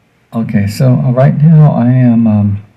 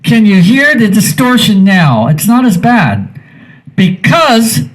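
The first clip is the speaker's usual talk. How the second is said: high-pitched sustained yelling; demo scream; 8 semitones higher than usual. Shown as a demonstration. Showing listeners the compressor tames distortion, screamed high. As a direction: yell it out, pitched way up. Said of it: "I'm gonna scream now".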